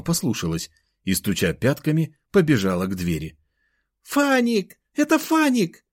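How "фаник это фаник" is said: put-on high, delighted little-girl cry; joyful cry. A narrator's reading. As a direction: call out gleefully in a very high child's voice. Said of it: "раздался из прихожей радостный крик". (радостный детский крик)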